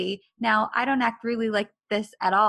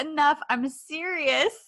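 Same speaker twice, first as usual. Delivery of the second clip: insistent protest; 8 semitones higher than usual. Retold or imitated. Retold demand to stop.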